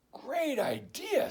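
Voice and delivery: high, slow enthusiasm; lightly voiced dog. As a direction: praise it eagerly. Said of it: "said Dog".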